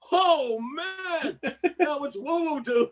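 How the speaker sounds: high, laughing mock amazement; laughing exclamation. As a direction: squeal it out through laughter. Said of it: "I went".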